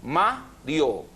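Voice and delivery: "po-e-ma-rio" split into four slow syllables. Shown as a demonstration. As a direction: say "po-e-ma-rio" with a gap after each syllable. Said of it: "Poemario se divide en".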